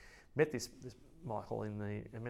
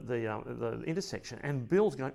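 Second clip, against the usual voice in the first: ordinary delivery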